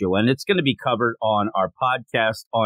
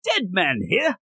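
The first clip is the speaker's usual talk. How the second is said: badly faked British accent, raised pitch; 5 semitones higher than usual. Shown as a demonstration. Host voices Dead Man in a really bad British accent. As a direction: do a terrible British chimney sweep. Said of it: "like, a really bad British accent".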